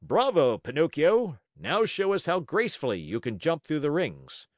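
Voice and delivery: loud, high ringmaster's call; showy circus-master announcement; crying out. A narrator's reading. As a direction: cry out to the donkey for the whole crowd. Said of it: "cried out".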